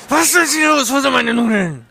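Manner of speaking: furious yelling, high and loud; angry shout. Retold or imitated. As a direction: roar it at the top of your voice.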